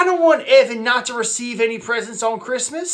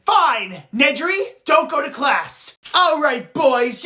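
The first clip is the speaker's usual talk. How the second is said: usual speaking voice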